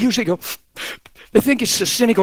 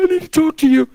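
hammed-up weepy new believer; imitated tearful confession; 9 semitones higher than usual. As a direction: blubber a confession in parody. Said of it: "who's ever seen that?".

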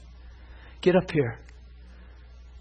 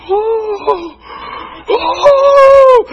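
loud, very high whoops, growing louder; imitated whooping; 14 dB louder than usual. Shirley marches round the church, imitated loud whooping. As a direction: whoop high like the healed woman.

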